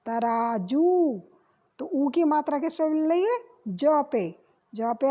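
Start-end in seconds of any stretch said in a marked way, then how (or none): 0.1-1.2 तराजू said very slowly, long ऊ at the end; sounding out the word. Shown as a demonstration. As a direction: stretch out तराजू. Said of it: "शब्द क्या होता है?". (तराजू — धीरे, लंबा ऊ)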